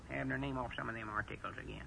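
ordinary delivery

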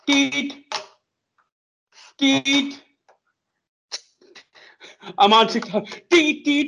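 high sing-song phone beeps; distracted cashier mimic. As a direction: chirp the beeps up high, absent-minded. Cashier ignoring customer on phone.

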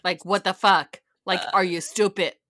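punchy imitation of an exasperated mom; fed-up mom. Mom weeding in the backyard, fed up with daughter. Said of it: "mom is, like, in the backyard".

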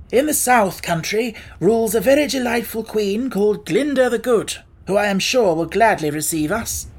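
high, loud, eager figure voice; confident Scarecrow. Acted. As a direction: announce the plan with assurance.

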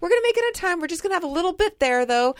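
high, brisk mock-reassuring parent; false reassurance. Mom fibbing to anxious kid on the drive, high mock reassurance. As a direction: imitate a parent chirping a comforting fib. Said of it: "I can no longer lie".

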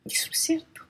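breathy under-the-breath whisper; soft conspiratorial whisper; whispered aside. Real, not acted. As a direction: murmur it so only the table hears. Whispered aside during argument.

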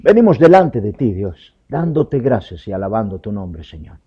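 a pastor leading the congregation in prayer. Acted. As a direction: open the prayer with force, then settle. Leads closing prayer.

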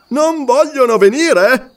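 domanda ringhiata, più alta e lenta; ringhio minaccioso. Acted. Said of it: "Ringhiò Umslopogaas". (Umslopogaas ringhia contro chi rifiuta)

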